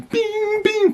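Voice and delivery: high, ringing "bing" for each blow; imitated punch impacts. Storyteller recounting punches to his temples, imitated ringing impacts. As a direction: voice each hit as a pitched ping. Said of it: "punch after punch".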